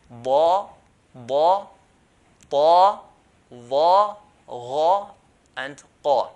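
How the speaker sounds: "Ba, ta, va, gha, qa" loud and thick; heavy letters. Shown as a demonstration. Teacher listing seven letters for students, heavy and emphatic. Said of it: "pronounce it in a heavy manner".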